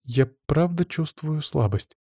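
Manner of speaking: musing, unhurried confession; pensive. A narrator's reading. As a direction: admit it slowly, thinking it over. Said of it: "задумчиво кивнула Лена".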